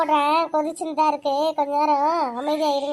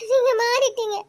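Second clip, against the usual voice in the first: high, louder, breaking into a sob; sobbing; 8 semitones higher, 5 dB louder than usual. Acted. Wife accusing her husband, breaking into sobs. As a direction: cry out "நீங்க" with a sob. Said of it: "இப்போ எதுக்குடி அழுதுட்டு இருக்க?".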